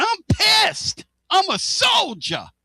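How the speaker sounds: quoting a player's post-game rant, shouting; mock angry shout. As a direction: bellow it like a fired-up player.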